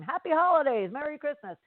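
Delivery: imitating a high chorused reply; crowd reciting back. Line of people answering her greeting, reciting back. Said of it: "reciting back at the same time".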